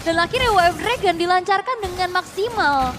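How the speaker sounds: loud, high excited call; esports caster. Real, not acted. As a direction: hype up the play. Caster hypes the play.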